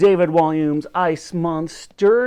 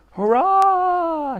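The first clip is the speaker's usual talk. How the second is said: very high, long cheer; the soldiers cheer passing under the bridge, high and long; cheering shout; 3 dB louder than usual. A narrator's reading. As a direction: cheer as the ship passes. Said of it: "shouted the soldiers on the ship".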